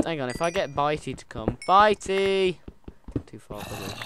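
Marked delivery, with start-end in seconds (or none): 1.7-2.6 high, loud call to a pet. Real, not acted. Player calls Bitey over to come, calling out. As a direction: shout the name out, high and drawn.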